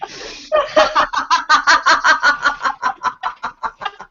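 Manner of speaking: high bright greeting laugh; greeting laughter. Shown as a demonstration. Teacher greeting Margarita: high greeting laugh. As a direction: laugh hello with a bow. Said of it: "Greeting laughter".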